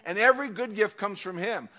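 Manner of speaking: usual speaking voice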